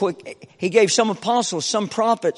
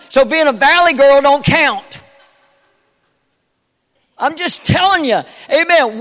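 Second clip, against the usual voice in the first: loud, high, building preaching at full force; anointed preaching; 4 semitones higher, 5 dB louder than usual. Real, not acted. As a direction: preach it with fire to the congregation. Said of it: "I didn't mean to get anointed".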